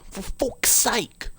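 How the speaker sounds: high, drawn-out strained whispery squeal; slow high airy exclamation; Torgy Porgy. Acted. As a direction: squeeze out an exasperated high rasp. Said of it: "I'm talking like Torgy Porgy".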